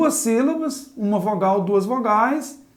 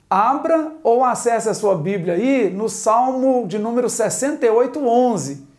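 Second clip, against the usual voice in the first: ordinary talk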